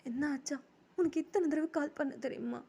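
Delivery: high-pitched acted crying reproach; tearful complaint. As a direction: whine it as if in tears. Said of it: "எதுக்குடா இப்படி அழுகுற".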